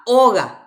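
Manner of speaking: oga, loud on the opening O; stress on first O. Shown as a demonstration. Teacher quizzing class on tonic vowel, stress on first O. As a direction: punch the O at the start of oga. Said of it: "Vocal tónica".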